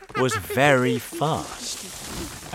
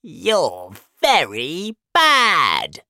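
high, loud, drawn-out scolding; storybook monkey's outraged telling-off; angry monkey; 8 semitones higher, 8 dB louder, much slower than usual. Acted. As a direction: shout it slowly, stretching each word.

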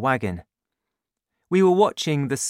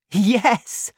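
put-on higher, louder eager boy; eager yes; 10 semitones higher, 5 dB louder than usual. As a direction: jump at the idea. Danny agrees eagerly.

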